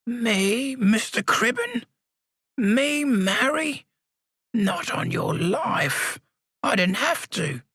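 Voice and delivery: put-on high, slow old man voice, teasing; a cheeky old codger brushing it off; whimsical old man. A narrator's reading. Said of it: "with a touch of whimsy in his aged voice".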